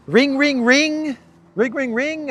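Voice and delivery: high sing-song "ring, ring"; mock phone ring. Vlogger acting out the prank call, high mock ring.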